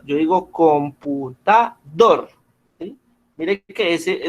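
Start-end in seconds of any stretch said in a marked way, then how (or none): 0.5-2.3 slow "computador" with a separate, stressed DOR; stress on last syllable. Shown as a demonstration. Teacher showing where the force goes, slow "computador" stressed on DOR. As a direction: break up "computador" and land on DOR. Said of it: "la fuerza o el acento está en esta sílaba".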